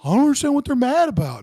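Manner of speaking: high, put-on voice of a baffled guy from Alaska; imitating a clueless army buddy. Retelling the Alaska recruit to a cohost, high mock-innocent voice. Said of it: "and he was like".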